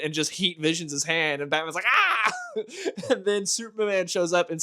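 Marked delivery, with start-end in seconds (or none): 1.9-2.3 high yelp of pain, hammed up; mock pained yelp. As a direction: yelp like a burned hand. Batman's hand gets burned.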